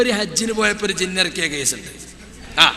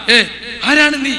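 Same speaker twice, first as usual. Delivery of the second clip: raised, higher voice interrogating a spirit; hammed-up exorcism commands; mock exorcist; 5 semitones higher, slower than usual. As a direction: imitate a jinn healer ordering it out in short bursts. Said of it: "മൂപ്പരിങ്ങനെ പറയാ".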